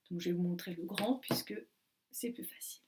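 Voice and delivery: soft whispered aside; whispered classroom aside. Real, not acted. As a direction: drop to a whisper.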